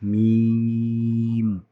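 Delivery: "মিম" with a long, level held vowel; lengthened sound. Shown as a demonstration. Teacher shows that the sound will be long, drawn-out "মিম". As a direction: stretch "মিম" out slowly on one steady note. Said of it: "আওয়াজটা একটু লম্বা হবে".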